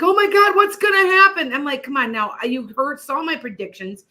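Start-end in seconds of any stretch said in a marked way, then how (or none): 0.0-1.5 high, loud panicky wail; imitating crying daughter. Daughter calling her mother in tears, high loud panicky quote. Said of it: "crying 'cause she's like".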